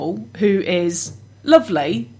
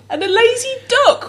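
laughing; 5 dB louder than usual. Real, not acted. Laughing at the picture.

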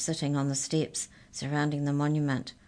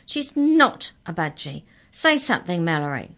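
protest; 8 dB louder than usual. A narrator's reading. Arthur protesting to giants.

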